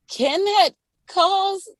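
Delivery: high, drawn-out disbelieving question; incredulous question. Retold or imitated. Massage therapist retelling her reaction to a client's story, incredulous. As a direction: stretch it out high, in mock disbelief.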